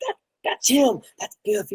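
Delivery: high, hushed, thrilled; excited hushed spotting. Retold or imitated. To his friend, spotting the stage director: excited hush. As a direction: point him out in a giddy hush. Poking each other spotting Biff.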